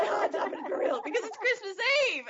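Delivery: high, breathless, laughing squeal; imitating excited Jake. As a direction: gush like a fanboy whose dream came true. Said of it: "Jake is like".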